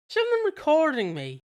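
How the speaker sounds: high-pitched startled query; mock startled question. Imitating a man noticing he is being recorded, startled and high. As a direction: jump up in pitch, mock alarmed. Character discovers hidden camera.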